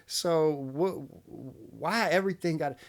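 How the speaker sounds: usual speaking voice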